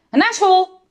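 abrupt marching command; schoolmaster's command. A narrator's reading. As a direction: order the children off like a drill master. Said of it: "riep hij".

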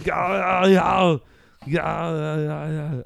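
mock action-hero yowls, fading; repeated silly yells that die away; Arnold Schwarzenegger. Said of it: "that's how he does his".

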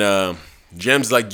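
usual speaking voice

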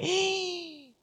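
sharp, high, breathy gasp of shock; retold shocked gasp. Preacher recalling a prophet's words, a high shocked gasp. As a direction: gasp like cold water just hit you. Felt a bucket of cold water.

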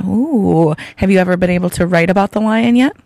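ordinary talk

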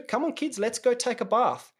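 lightly voiced nanny, pitched high; mock nanny. Voicing the nanny rallying kids.

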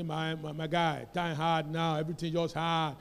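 a hint of a downcast complainer; mock complainer.

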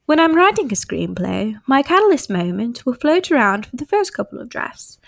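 put-on English accent, read aloud with performed lilt; British accent with theatrical narrator phrasing. Shown as a demonstration. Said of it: "do your English accent".

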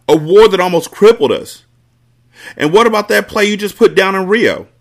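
loud, high, heated tirade; angry accusation. Acted. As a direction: rail at them one by one. Carter confronts the crew.

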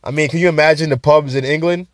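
ordinary talk

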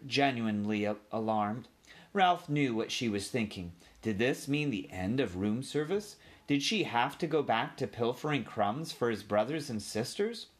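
plain reading voice

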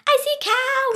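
high, loud excited shout; playful scream. Shown as a demonstration. Child showing the sea-cow ritual, screaming. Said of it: "you gotta scream".

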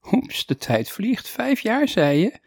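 high-pitched, mildly startled reaction; surprised. A narrator's reading. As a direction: lift the pitch sharply in light surprise. Teacher surprised at years passed.

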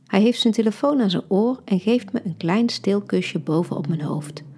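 ordinary delivery

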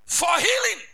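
high, loud preaching cry; preacher's loud call. Real, not acted. Preacher listing reasons to lay hands, called out loudly. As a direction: call it out to the congregation. Preacher calls out purposes of touch.

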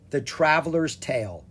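ordinary delivery, nothing marked

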